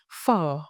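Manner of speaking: Phở said slowly, one syllable, dipping then rising; one clean syllable Phở with a dip-rise tone; clear model word. Shown as a demonstration. Teacher breaks the word down for learners, carefully modeled. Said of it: "Let's break it down step by step".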